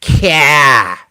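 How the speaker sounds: drawn-out Boston "car" with a dropped r; Boston accent. Shown as a demonstration. Streamer showing viewers how Boston talks, Boston accent. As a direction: give a demo of a Boston accent. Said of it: "the Boston accent where they say".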